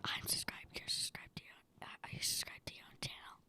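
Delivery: a voiceless, low whisper right at the mic. Real, not acted. Son whispering into the microphone at the podcast's end, playful whisper. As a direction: keep it all breath, playful and secretive.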